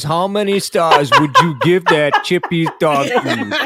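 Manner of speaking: mock Alexa. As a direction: parody a smart speaker survey. Imitating Alexa survey prompt.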